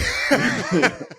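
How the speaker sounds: high-pitched burst of laughter. Real, not acted. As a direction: cracks up laughing at himself. Laughing at story.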